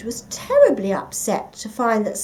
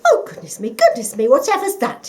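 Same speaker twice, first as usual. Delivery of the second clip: startled; 3 semitones higher, 8 dB louder than usual. A narrator's reading. Rubbish falls on Mole.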